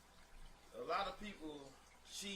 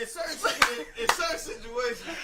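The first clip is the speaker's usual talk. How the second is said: raised voice to be heard; loud; 8 semitones higher, 14 dB louder than usual. Real, not acted. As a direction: cut through the noise loudly. Shouted defense over laughter.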